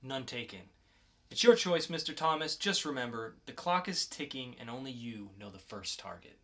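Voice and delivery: lightly voiced calm interrogator, cool and in control. Podcast host reads the mysterious agent to a captured teen, cool and controlled.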